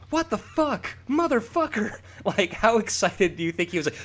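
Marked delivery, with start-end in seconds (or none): 0.1-2.0 contained high mock shout; mock yelling. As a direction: imitate a wrestler yelling, pitched way up. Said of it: "just yelling".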